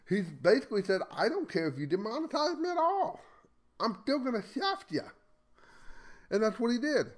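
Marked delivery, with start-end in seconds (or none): 1.1-5.0 much higher-pitched defiant retort; retold defiance. Host voicing Crowder's stand against being demonetized, retold defiance. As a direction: throw it back defiantly in a raised pitch.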